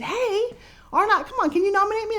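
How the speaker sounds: hammed-up high begging on the phone; high pleading mock voice. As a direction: beg sweetly like a kid asking a favour. Retold phone call asking for nomination.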